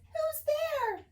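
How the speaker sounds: very high startled squeak; crying mouse. Acted. Mouse calling into the dark den, high squeak. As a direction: squeak out in alarm. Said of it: "Mouse cries".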